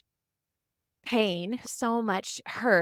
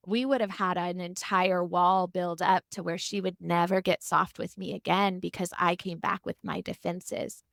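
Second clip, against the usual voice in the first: ordinary talk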